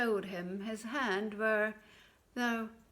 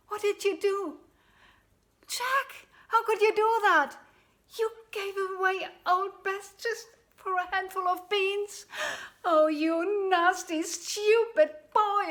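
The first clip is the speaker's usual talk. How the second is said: high, loud, shrieking rage; mother berating her foolish son; furious scolding; 10 semitones higher, 9 dB louder than usual. Acted. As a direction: tear into him.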